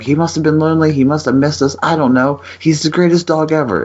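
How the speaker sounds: high-pitched mock pleading; retold gushing defence. Husband defends the dog to his wife, high and mock-pleading. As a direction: plead the dog's case in a high voice.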